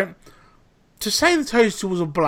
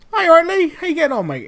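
higher, louder, sing-song mock-cheery hello; fake breezy greeting; 12 semitones higher, 5 dB louder, faster than usual. Chef greeting the manager while the toaster burns, mock-cheery. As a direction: breeze up as if nothing's wrong.

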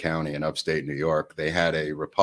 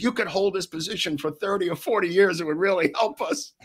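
laughing through quote; 12 semitones higher than usual. Retold or imitated. Retells plea while laughing.

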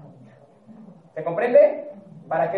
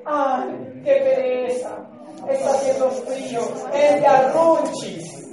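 lazy inner voice; 3 semitones higher, slower than usual. Retold or imitated. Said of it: "la emoción le dice".

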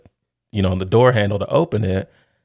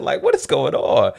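usual speaking voice

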